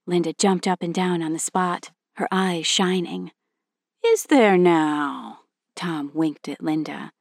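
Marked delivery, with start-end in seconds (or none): 4.0-5.4 drawn-out knowing dad; teasing father voice. Acted.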